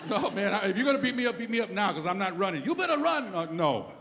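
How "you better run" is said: much higher mock threatening voice; mock threat. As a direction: taunt him to run. Parking lot threat.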